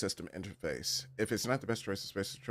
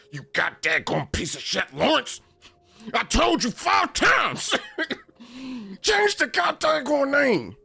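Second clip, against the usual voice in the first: much higher, louder, slow raging scream; furious shout; 9 dB louder, slower than usual. Retold or imitated. Olivia screaming at Lawrence to change the name, hammed-up rage. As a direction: scream it at him in mock fury. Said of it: "she down here".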